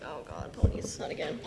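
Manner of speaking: low, weary groaning whisper; family groaning at the ponies again; mock exasperation. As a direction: imitate the family's fed-up sigh. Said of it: "they are just like".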